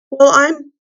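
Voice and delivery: high, loud, broken-off exclamation; flabbergasted. A narrator's reading. Eustace reacts to Jill's exploit, high and flabbergasted. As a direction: burst out, then halt for words. Eustace lost for words, amazed.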